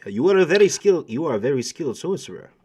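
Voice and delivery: put-on fast, lightly accented Spanish compliment; Martin's Spanish accent. Captain Martin praising the sorcerer in a Spanish accent. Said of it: "I forgot that to speak as Martin".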